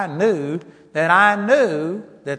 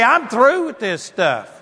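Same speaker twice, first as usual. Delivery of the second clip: slow, higher, emphatic declaration; fed-up anger; 5 semitones higher, 4 dB louder than usual. Acted. Believer telling the devil it's over, fed-up anger. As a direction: draw the line, acting fed up. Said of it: "get mad at the devil".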